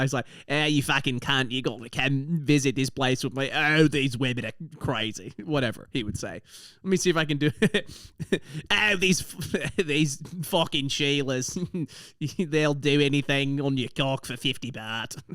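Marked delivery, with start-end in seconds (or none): 0.5-5.3 hammed-up Australian accent; imitating a crude Australian mate; classic Australian guy. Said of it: "he's a classic Australian guy". 8.7-15.2 hammed-up Aussie accent, breaking into laughs; broad Australian bloke, trying not to laugh; amateur take on a crude Australian; Australian impression. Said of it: "That's the best impression I can do".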